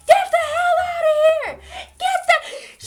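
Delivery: very high, loud imitation of an irate grandmother; hammed-up high-pitched yelling. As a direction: screech it like a grandmother hollering from the back room. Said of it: "she's yelling at this point".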